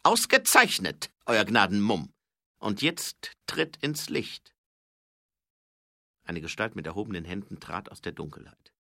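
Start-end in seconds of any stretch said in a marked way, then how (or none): 0.0-4.5 triumphierender Räuber. Acted. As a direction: call out commandingly to a cornered opponent. (lauter, triumphierender Räuber)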